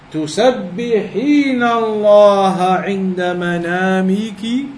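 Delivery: ordinary talk, nothing marked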